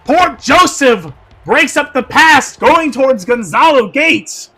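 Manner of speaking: ordinary talk, nothing marked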